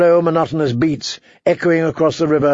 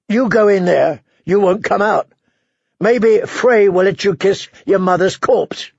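raised, forceful warning; harsh, scornful warrior; 5 semitones higher than usual. Acted. Warrior warning the girl off the castle, harsh and scornful. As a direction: throw the grim warning at the child, pitched up. Voices the Hound.

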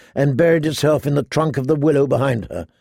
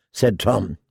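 ordinary delivery

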